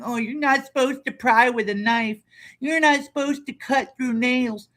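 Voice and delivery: high, sing-song mocking whine, slowing at the end; mocking whiny commenter. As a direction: parody a complaining commenter. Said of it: "go beyond just crying".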